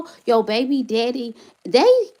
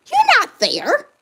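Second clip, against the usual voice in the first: much higher and slower, stretched out; "You're not there" lifted high and drawn out; pitched-up accusation; 12 semitones higher, slower than usual. Real, not acted. Mother confronting the absent father, high slow accusation.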